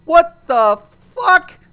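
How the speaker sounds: slow, loud, high disbelieving cry; incredulous outburst. Retold or imitated. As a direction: stretch it out in shocked outrage. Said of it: "you're kinda like".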